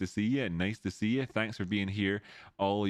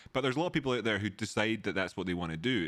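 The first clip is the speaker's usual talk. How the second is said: normal talking voice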